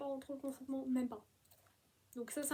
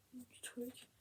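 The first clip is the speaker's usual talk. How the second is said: soft, breathy whisper; hushed wonder; awed whisper; 11 dB quieter than usual. Real, not acted. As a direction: whisper it in awe. Marveling at the book.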